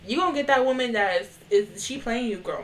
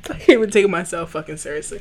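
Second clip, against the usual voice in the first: ordinary talk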